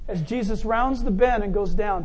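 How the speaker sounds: usual speaking voice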